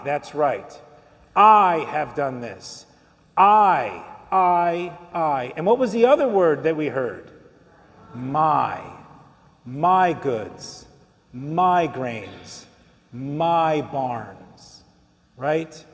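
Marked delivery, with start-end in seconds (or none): 1.3-5.5 "I" drawn out and punched, then repeated alone; emphatic repetition. Shown as a demonstration. Preacher shows which word was used six times, hammering "I". 8.1-14.8 low, separated phrases, each led by "My"; emphatic listing. Shown as a demonstration. Preacher answers what other word was heard, low emphatic "My".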